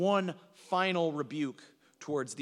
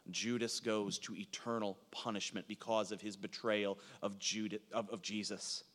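hushed, low and solemn; grave preaching; 9 semitones lower, 8 dB quieter than usual. Real, not acted. Preacher tells congregation of Judas's fate, hushed and grave. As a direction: drop to a soft, sombre sermon voice.

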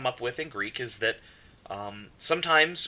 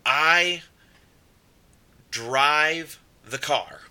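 "drive the car" drawn out slowly; slow example sentence; 3 semitones higher, much slower than usual. Shown as a demonstration. Teacher slowly reading an example to students. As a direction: spell out the example word by word. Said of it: "for instance, if I say".

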